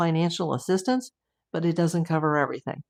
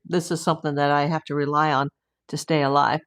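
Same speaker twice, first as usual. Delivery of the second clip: normal talking voice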